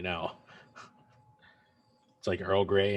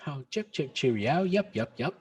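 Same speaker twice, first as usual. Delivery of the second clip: hammed-up British accent, higher pitch; mock British accent; 6 semitones higher than usual. Co-host teasing the host with a mock British accent. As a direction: chirp it like a caricature Brit. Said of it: "saying things in a British accent".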